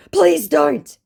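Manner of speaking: a quick panicked beg; hurried scammer plea. Retold or imitated. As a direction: scammer rushing to stop her telling mum. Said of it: "he hurriedly puts in".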